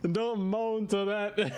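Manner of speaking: drawn-out, very slow, high teasing scold; high, very slow mock reproach. Real, not acted. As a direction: stretch every word out as a teasing scold.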